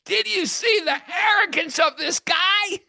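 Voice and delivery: high, loud, outraged yell; indignant shout. Retold or imitated. Storyteller venting to his friend about the rude tourist, outraged high shout. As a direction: blurt it out in disbelief at the arrogance.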